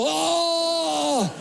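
long, high hammed-up wail; mock death cry, held long; super dramatic war-film cry. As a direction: cry out like a dying movie soldier. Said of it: "net als in die film Platoon".

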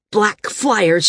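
mildly angry scornful report; understated indignation at the threat. A narrator's reading. Landsman reports Maris's threat to San, mildly angry. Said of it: "the landsman said angrily".

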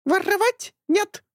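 high slow indignant squawk; indignant bird. A narrator's reading. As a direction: squawk in outrage like a parrot. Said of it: "возмутился Орев". (возмущённый птичий крик)

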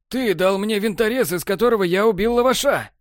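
high, pressing accusation; accusing realization. A narrator's reading. Borland accusing Technar, high pressing voice. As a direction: lay the charge out as it dawns. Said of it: "медленно сказал Борланд".

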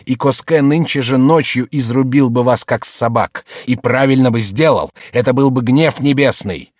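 indignant rebuke, swelling into outbursts; angry tirade. Acted. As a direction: denounce them bitterly, throwing the insults hard. Brother furiously denounces and expels sister. (гневная обличительная тирада)

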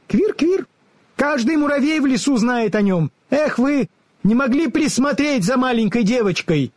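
high, squeaky scolding squirrel; squeaky animal telling-off; mocking squirrel. A narrator's reading. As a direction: heap scorn on them in a tiny squirrel voice. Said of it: "Белка осыпала друзей такими насмешками".

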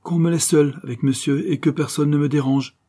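a master's curt, imperative command; imperious command. A narrator's reading. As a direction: bark the order at the servant. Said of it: "en un accent impératif".

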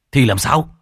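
urgent demand. A narrator's reading. Toàn urgently cuts in.

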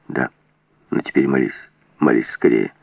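very low, dark command; low, ominous insistence; grim urging. A narrator's reading. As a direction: press her grimly to hurry. Armed man hurries her to pray.

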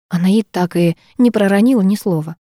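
plain reading voice

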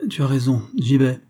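usual speaking voice

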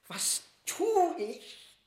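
high, slow, loud panic; frightened secretary asking himself aloud; desperate cry. Acted. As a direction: cry out in helpless dread. Secretary left alone, panicking.

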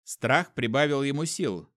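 usual speaking voice

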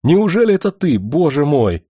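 overjoyed to see her; excited disbelief. A narrator's reading. Overjoyed reunion exclamation.

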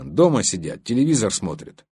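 usual speaking voice

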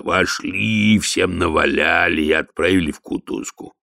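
slow, plain troll report; a steady troll making his report; troll sergeant voice. Acted. Detritus the troll speaks.

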